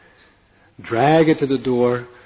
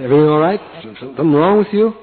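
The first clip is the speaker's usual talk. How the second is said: louder, higher concerned cabbie; a London cabbie checking on his passenger; taxi driver; 4 semitones higher, 6 dB louder, faster than usual. Retold or imitated.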